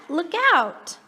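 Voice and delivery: usual speaking voice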